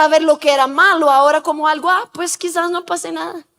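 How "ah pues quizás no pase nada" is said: soft, tentative rationalizing; half-convince yourself under your breath; weakened conscience. Retold or imitated. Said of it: "La conciencia se está quedando débil". (conciencia débil, imitada en voz suave)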